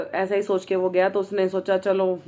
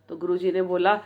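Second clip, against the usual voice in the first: ordinary delivery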